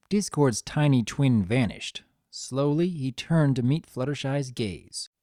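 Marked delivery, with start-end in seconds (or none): none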